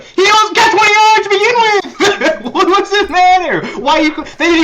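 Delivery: venting at the commentators, voice climbing high; exasperated rant. Real, not acted. Ranting at announcers, laughing.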